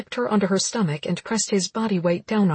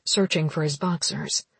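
plain reading voice

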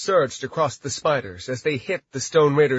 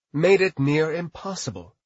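usual speaking voice

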